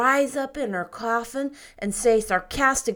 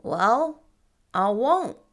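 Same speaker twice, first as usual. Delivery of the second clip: brightening; 7 dB louder, slower than usual. Acted. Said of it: "brightening up".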